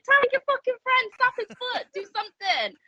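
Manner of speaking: high, pleading yell at the ring; screaming at a wrestler to tag his partner; mock shout. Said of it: "shouting at Jack".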